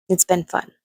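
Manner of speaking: dry, sarcastic "It's been fun" with weight on been; deadpan irony, meaning the opposite. Real, not acted. Telling viewers about her abuse, dry sarcastic aside. Sarcastic line, then taken back.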